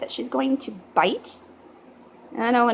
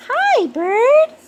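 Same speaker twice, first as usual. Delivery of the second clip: high excited greeting; 10 semitones higher, 7 dB louder than usual. Real, not acted. Greeting pet bird.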